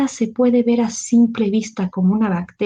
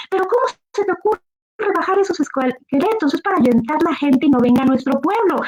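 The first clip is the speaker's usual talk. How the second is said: high, fast scolding rant; exasperated scolding; 8 semitones higher than usual. Acted. Master scolds Juan for taking down the skeletons, high and fast. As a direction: tear into him, exasperated.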